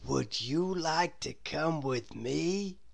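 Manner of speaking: soft slow invitation; Mister Daydream voice. A narrator's reading. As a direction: coax him gently along.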